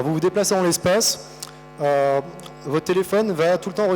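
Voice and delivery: normal talking voice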